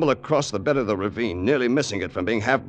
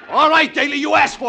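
loud grim threat; tense gunpoint threat; 7 dB louder than usual. Acted. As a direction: spit it out before firing. About to shoot.

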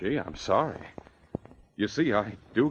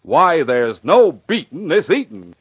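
overexcited hype; 7 semitones higher, 7 dB louder than usual. Acted. Announcer forgetting himself over cereal, building to a yell. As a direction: pitch building to a high, loud shout. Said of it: "There you go again".

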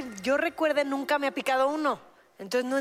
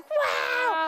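excited wow; 12 semitones higher than usual. Retold or imitated. Daughters' excited reaction retold.

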